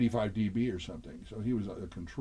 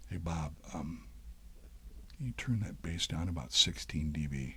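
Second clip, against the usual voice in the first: softer, lower, private ask; discreet request; 6 semitones lower, 6 dB quieter than usual. Retold or imitated. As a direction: lean in with a quiet, casual favour. Private word to the engineer.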